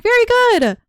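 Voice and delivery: high, loud teacher praise; YouTuber voice. Retold or imitated. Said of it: "use your YouTuber voice".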